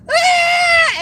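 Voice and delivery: very high, loud imitated scream; startled mock scream. Cousin waking to a dead fish, startled scream.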